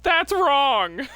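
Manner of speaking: high, loud mock verdict; playful loud rejection. Real, not acted. Host rejecting a chatter's joke guess, playfully loud. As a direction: call it out loud and high through the laughter.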